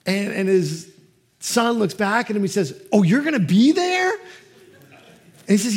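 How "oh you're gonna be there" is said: high, loud, delighted surprise; light up at the news; surprised toddler. Retold or imitated. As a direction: son learning dad will come, high and delighted. Son's relief at the news.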